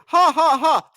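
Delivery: loud, high mocking ha ha ha; mocking loud laugh. Streamer mimicking rival fans' taunting laughter, loud and mocking. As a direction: laugh at them the way the fans did.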